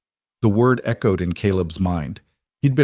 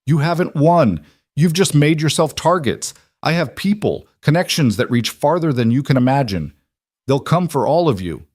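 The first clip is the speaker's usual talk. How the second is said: higher, heated threats from a cornered man; shouted threats; 6 semitones higher, 4 dB louder than usual. A narrator's reading. Restrained cattle baron to his captors, shouted threats. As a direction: warn them all, composure cracked. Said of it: "still shouting threats".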